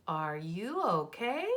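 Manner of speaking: slow "Are you okay?" with OKAY rising at the end; question intonation. Shown as a demonstration. Teacher rereads dad's line to check punctuation, rising question tune on OKAY. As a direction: turn it into a clear question, lifting OKAY. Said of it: "my voice sounded like a question".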